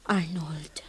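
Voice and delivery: barely-voiced whisper of his name; breathless whisper. Wife whispers his name, moved. (naanasan nga ibarsak ti nagan)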